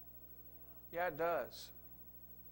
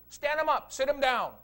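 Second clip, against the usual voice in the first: higher, louder bossy orders; mock frantic orders; 6 semitones higher, 7 dB louder than usual. Mimicking a chaotic deliverance leader, loud high orders. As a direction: rattle off orders in a mock frenzy.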